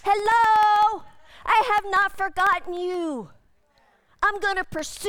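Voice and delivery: loud, high call through a microphone; microphone shout. Retold or imitated. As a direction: shout it like a street preacher. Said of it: "shout in microphones".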